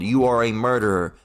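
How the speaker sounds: usual speaking voice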